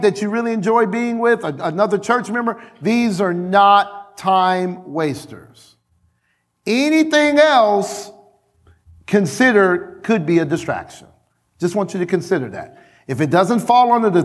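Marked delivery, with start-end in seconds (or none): 2.8-11.1 slow emphatic statement. Shown as a demonstration. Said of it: "repeated sermon point".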